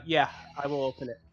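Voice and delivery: normal talking voice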